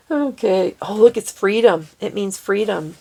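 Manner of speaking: ordinary talk, nothing marked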